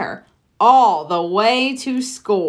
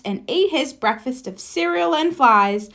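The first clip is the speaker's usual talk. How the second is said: ordinary delivery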